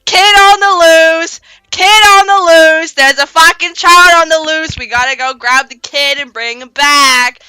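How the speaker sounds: high, loud, slow alarm-call chant; factory siren blaring the escape. Retold or imitated. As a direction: wail it like a siren. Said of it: "sirens are going off like".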